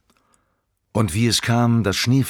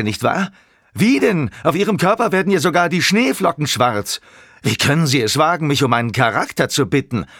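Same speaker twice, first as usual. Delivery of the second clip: high, heated, defiant tirade; raised voice, mounting outrage to the end; indignant outburst; 8 semitones higher, 3 dB louder than usual. A narrator's reading. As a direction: denounce him with righteous fury. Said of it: "rief er".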